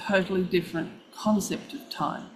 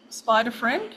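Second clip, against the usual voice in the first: ordinary talk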